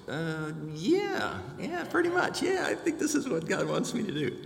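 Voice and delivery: high, hesitant yeah, yeah, pretty much; mock confident. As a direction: bluff a confidence you don't really feel, pitched high. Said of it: "in my most confident voice".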